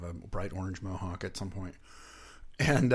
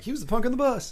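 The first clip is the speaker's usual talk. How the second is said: mock epitaph; 7 dB louder than usual. Mock epitaph after Nobel Prize.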